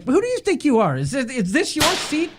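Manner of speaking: high, indignant mock scolding; a retold lady putting a rude man in his place; mock telling-off. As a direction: imitate her tearing into him. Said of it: "she went off".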